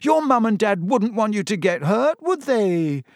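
high-pitched, imploring, near tears; tearful pleading. A narrator's reading. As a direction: beg a friend to see sense. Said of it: "her eyes now shining with tears".

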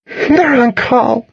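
high, drawn-out wail; panicked helplessness. A narrator's reading. Panicked friend answering about the parents, wailing. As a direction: cry it out helplessly.

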